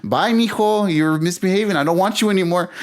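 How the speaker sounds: put-on teasing as if giving the kid away; mom's mock goodbye. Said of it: "The mom goes".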